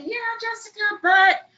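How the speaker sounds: high-pitched imitation of a doubtful guest; skeptical guest. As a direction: put on a hesitant objector, pitched well up. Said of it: "they're gonna say".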